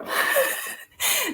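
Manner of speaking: high laugh. Real, not acted. Laughs at her own joke.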